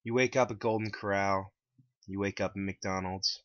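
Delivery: ordinary delivery, nothing marked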